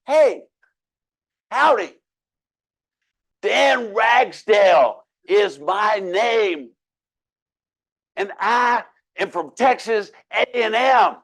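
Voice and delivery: loud, slow, over-enunciated hello; slow loud greeting. Retold or imitated. Dan greets the French passport holder, loud and slow. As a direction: spell it out loudly so a foreigner can follow. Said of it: "is speak slow and maybe a little louder".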